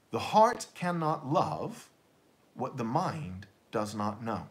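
The heart cannot love said slowly with heart lifted, mind does not know falling low; slow quotable maxim. Shown as a demonstration. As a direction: set it down as a proverb, stressing heart and mind. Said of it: "You might say it like this".

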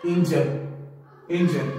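"एंजल" with a long AYN, AYN-jel; correct pronunciation. Shown as a demonstration. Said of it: "हम इसको pronounce करते हैं".

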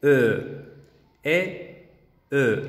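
"E" open with lips rounded. Shown as a demonstration. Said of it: "redondeamos los labios".

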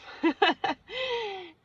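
high, loud burst of laughter; laughing at own cheeky joke; real laugh. Real, not acted. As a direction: crack up, high and hearty.

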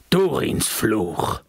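very slow, dread-heavy dwarf cry; dwarf's cry. A narrator's reading. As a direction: drag the curse out in horror. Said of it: "rief er".

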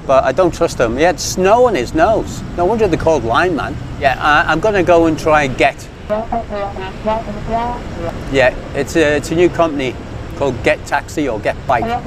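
normal talking voice

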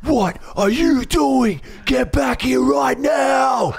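high, slow mock yell like an angry teacher; officer yelling. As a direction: bark it out like a furious teacher scolding a kid. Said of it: "it's like a teacher yelling at you".

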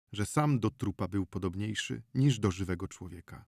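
ordinary delivery

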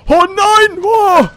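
high loud cry of dismay; frightened scream. Real, not acted. Player screams at every door in the game. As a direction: shriek as the door bursts open. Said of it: "Ich schrei bei jeder Türe rum".

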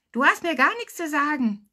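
high, loud, squeaky defiance; piping child. A narrator's reading. Small boy talking back to the lifeguard, piping. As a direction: pipe up like a cheeky little boy. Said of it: "drehte sich zu mir um und piepste".

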